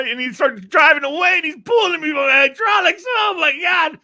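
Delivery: loud, very high strained squeal; hammed-up agony while being dragged; mock pained panic. Retells being dragged by the groin.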